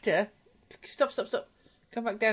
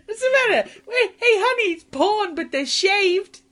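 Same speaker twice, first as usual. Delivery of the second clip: high, loud, laughing mock panic; hammed-up high yelp, slowing at the end; 12 semitones higher, 8 dB louder than usual. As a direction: shriek it in fake alarm over the browser tab. Mock panicked reaction to porn tab.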